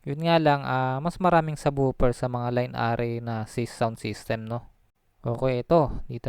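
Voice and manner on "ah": ordinary talk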